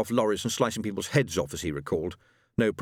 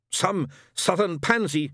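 scornful sneer; 9 semitones higher, 3 dB louder, slower than usual. A narrator's reading. Shadwell scornful.

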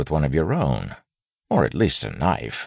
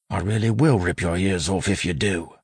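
put-on raised-pitch threat in street-tough accent; 6 semitones higher than usual. A narrator's reading. Wayne privately warning the bandit, street-tough accent. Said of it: "speaking in the street-tough accent".

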